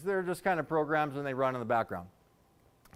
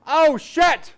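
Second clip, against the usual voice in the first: put-on higher, much louder sudden yell, child's voice; toddler's yell; 10 semitones higher, 9 dB louder than usual. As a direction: shout it out of nowhere like a three-year-old. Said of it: "she yells".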